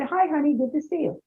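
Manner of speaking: soft, restrained hello; calm low-key greeting. Retold or imitated. Wife greeting husband with quiet restraint, softly. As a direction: greet him quietly and hold back. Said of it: "I said".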